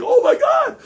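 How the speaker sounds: high, loud panicked oh my God; panicked cry. Retold or imitated. Grabbed from behind in the hallway, panicked high cry. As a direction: cry out as the ghost holds you. Held by something, can't run.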